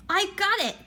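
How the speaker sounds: high burst of discovery, leap up for it; excited. A narrator's reading. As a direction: blurt it out like a eureka. Charlie's sudden idea.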